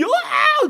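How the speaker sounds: very high-pitched fake bawl; exaggerated crying howl; mock wail. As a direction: bawl along like a crying child. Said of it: "같이 울어요?".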